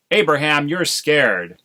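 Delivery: loud, high accusation; accusing. Acted. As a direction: call him out as scared. Wife accuses husband.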